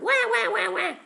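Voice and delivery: high loud mock baby wail; baby wah cries. Narrator voicing baby crying, high mock wail. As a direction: squeal it like a newborn. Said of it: "he cried".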